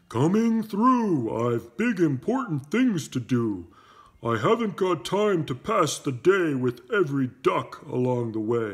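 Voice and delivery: bossy dump truck. Acted. Dump truck brushing past the animals, a loud bossy voice. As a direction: push through like a pompous big truck.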